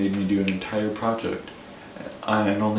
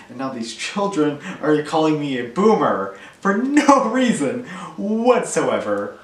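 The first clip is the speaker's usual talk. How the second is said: higher, louder, hammed-up outrage; mock-indignant rant; 5 semitones higher, 5 dB louder, slower than usual. Adult bullied by children calling them boomer, mock indignation. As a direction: build to an exasperated comic protest.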